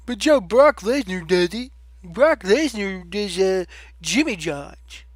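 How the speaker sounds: slow, whiny put-on voice; a put-on fan whining back at the commentator; mock objecting fan. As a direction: whine like a fan defending Brock. Voicing an imagined defender.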